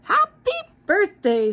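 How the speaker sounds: high, loud snowman voice; a big jolly snowman waking up; snowman's first words. Acted. Snowman greeting the children, high and loud. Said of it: "said the snowman".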